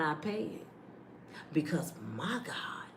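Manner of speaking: whispered, soft praise; reverent whisper. Acted. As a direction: breathe it like a secret prayer.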